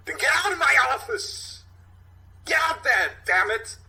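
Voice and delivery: captain ordering detectives out of his office, shouting; furious shout. Acted. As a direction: bellow them out the door.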